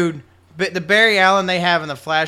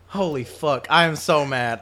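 normal talking voice

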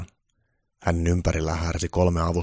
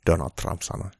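usual speaking voice

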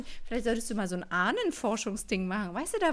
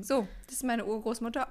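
ordinary talk

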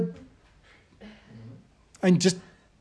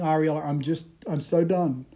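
weary defeat; 2 semitones lower, 8 dB quieter than usual. Retold or imitated. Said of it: "I think".

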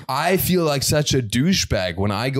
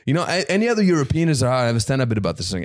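usual speaking voice

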